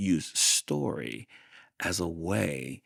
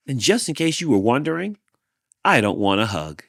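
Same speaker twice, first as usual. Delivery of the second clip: snappy refusal; 5 semitones higher, 7 dB louder, faster than usual. Acted. Hank rejects the lizard.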